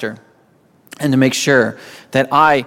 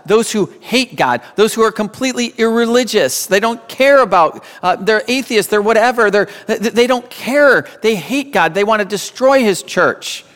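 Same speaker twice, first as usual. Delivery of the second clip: heated denunciation; 6 semitones higher than usual. Real, not acted. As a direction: pitch climbing high, pressing hard against God's enemies. Preacher denounces those hating God.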